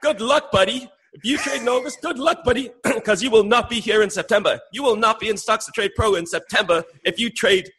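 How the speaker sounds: high, put-on voice mimicking cohost; squeaky send-up of his cohost's warning. Mimicking his cohost to his face, high put-on voice. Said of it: "I'm gonna try and mimic you".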